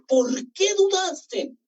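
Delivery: high, slow reproach; reproachful. A narrator's reading. Jesus rebukes doubting Peter, slow and high. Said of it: "lo reprende".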